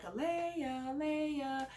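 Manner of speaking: high sing-song call of the name. Shown as a demonstration. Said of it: "So I would be like".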